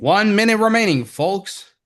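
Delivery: big booming announcer call; loud, high broadcast announcer. Pretend. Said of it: "let me do my announcer voice again".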